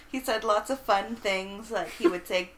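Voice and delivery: usual speaking voice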